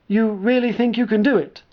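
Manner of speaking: a hint of an eager young lieutenant; lightly voiced young lieutenant. Sark asking doubtfully.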